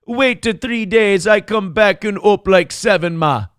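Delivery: playful put-on Jamaican reading; Jamaican patois. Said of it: "Hims look to his mother's eyes and say".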